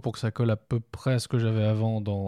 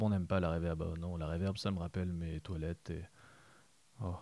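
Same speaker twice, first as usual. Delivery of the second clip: voix grave dégoûtée; 7 semitones lower, 11 dB quieter than usual. Acted. Mock disgusted aside on reverb.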